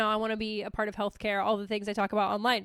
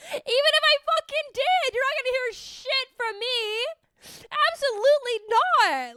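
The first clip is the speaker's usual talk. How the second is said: indignant refusal; 13 semitones higher, 6 dB louder than usual. Acted. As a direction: flatly refuse to give anything up. Defiant refusal continued.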